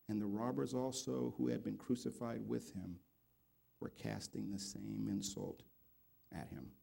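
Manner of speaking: very soft, low and solemn reading; subdued, grave Bible reading; hushed scripture reading. Acted. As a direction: read the verses quietly, letting them fade almost away.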